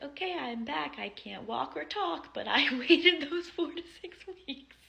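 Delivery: high, loud, proudly cheerful; loud mock cheerful. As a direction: announce it like good news, laughing. Mom's joking imagined return.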